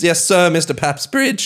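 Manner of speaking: high, eager-to-obey schoolboy answer; nervous pupil. Acted. [name] answering his old detention teacher, nervously high.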